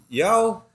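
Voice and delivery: YO pitched high as a question. Shown as a demonstration. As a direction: lift YO sharply, asking. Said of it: "you could question it".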